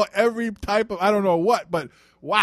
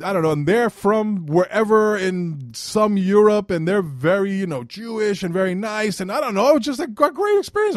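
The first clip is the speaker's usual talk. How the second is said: ordinary talk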